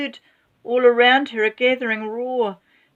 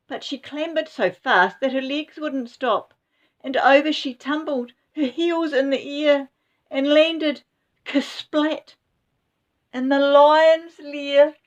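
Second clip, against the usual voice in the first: rising, faster telling of the fall; building excitement; 6 semitones higher than usual. A narrator's reading. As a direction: build the tension to the landing. Narrator builds to Grandma's fall.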